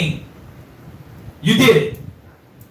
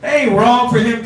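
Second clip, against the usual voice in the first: normal talking voice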